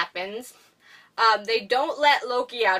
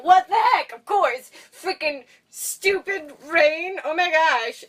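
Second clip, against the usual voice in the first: annoyed outburst; 6 semitones higher than usual. Retold or imitated. Darcy soaked by the rain, yelling in frustration. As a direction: blow up at the rain. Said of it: "she's like".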